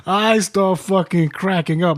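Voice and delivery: talking through real laughter; can barely get the words out for laughing; slow, laughing words. Real, not acted. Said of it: "I start fucking rolling".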